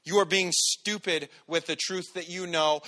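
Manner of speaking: ordinary talk, nothing marked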